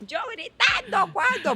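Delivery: high, drawn-out mock shouted protest; retold indignant yelling. Wife denies to husband that she is shouting, mock indignant yell. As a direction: yell the denial in playful outrage. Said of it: "estás gritando".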